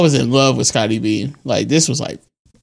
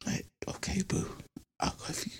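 whispering; 5 semitones higher, 21 dB quieter than usual. Pretend. Whispering to a crush.

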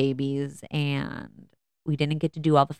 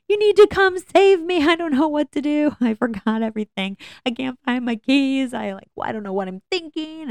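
much higher, louder frantic pleading; panicked plea; 10 semitones higher, 5 dB louder than usual. Retold or imitated. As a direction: mom phoning family about lost keys, panicked begging for help. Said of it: "I have this full-blown meltdown".

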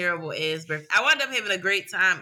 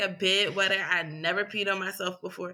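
ordinary delivery

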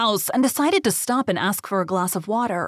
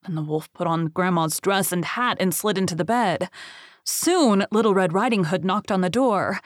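usual speaking voice

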